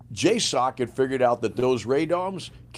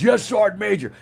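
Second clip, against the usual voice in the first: higher, loud sharp soldier answering; soldier's reply; 10 semitones higher, 6 dB louder than usual. Retold or imitated. Sergeant answering his sergeant major crisply. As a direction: snap back a crisp yes. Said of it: "He goes".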